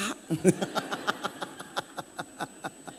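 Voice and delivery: long breathy chuckle; real drawn-out quiet laugh. Real, not acted. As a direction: laugh softly at your own punchline. Laughs after his own punchline.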